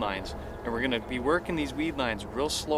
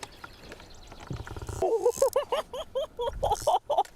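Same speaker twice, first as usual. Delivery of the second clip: high, breathless laugh of delight; giddy high laugh. Real, not acted. Big fish on the line.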